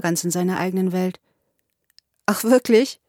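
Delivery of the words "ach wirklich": a touch of coolness; cool "oh, really"; cool reply. A narrator's reading. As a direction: answer with mild, cool disinterest. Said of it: "entgegnete ich kühl".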